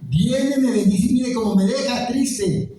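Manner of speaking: high, whining complaint; high, wounded complaint about the Pope; plaintive lament. Retold or imitated. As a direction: whine it, hurt and reproachful. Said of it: "yo decía".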